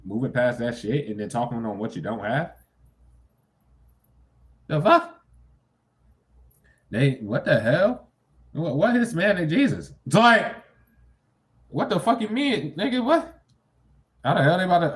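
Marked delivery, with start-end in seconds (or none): 4.7-5.2 high, loud incredulous yelp; shocked. Real, not acted. Streamer reacting to a post, shocked yelp. 6.9-14.9 high, loud incredulous outburst; disbelief. Real, not acted.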